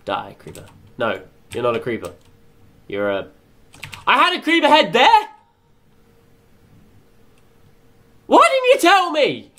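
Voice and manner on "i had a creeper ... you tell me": high, loud incredulous cry; can't believe nobody said; outraged disbelief. Real, not acted. Discovers he already had one.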